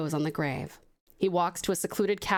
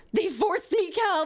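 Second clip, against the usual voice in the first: anguished grief; 11 semitones higher, slower than usual. Acted. Anaconda pleading over his brother's body, high and grieving. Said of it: "Anaconda cries over his brother's body".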